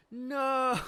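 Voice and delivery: loud, high, mock-disappointed wail; Chopper. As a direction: cry out in cartoon dismay. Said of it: "Chopper's disappointed".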